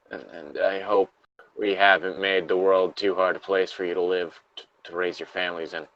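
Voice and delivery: a lightly acted trapped survivor, reading steadily; reading a Fallout lore log aloud to a friend, somber. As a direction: read the survivor's diary entry gravely. Reads the closing of the log.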